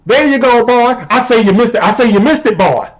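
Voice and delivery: high, blustering Southern caricature; hammed-up Southern good-old-boy; mock Southern accent with a folksy swagger. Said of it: "I know that the Southern accent".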